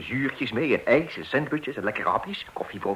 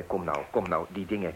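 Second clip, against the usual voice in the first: ordinary talk, nothing marked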